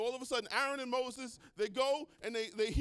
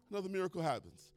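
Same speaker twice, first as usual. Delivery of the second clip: ordinary talk, nothing marked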